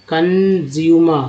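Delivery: कंज्यूमर said very slowly, kən-jyu-mər, falling at the end; slow word demo. Shown as a demonstration. Teacher breaking a word into parts for students, slow. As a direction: sound out कंज्यूमर slowly for learners. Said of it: "C O N कन".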